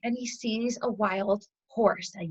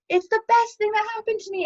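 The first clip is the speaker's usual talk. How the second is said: elated, high-pitched gush; squealy mock elation; acted high; 11 semitones higher than usual. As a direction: play being on top of the world, pitch way up. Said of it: "we have our highs".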